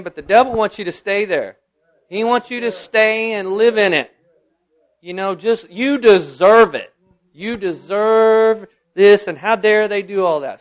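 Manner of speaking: loud, high preacher's declaration; forceful pulpit proclamation; impassioned preaching. Real, not acted. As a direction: preach it out, stretching the key words.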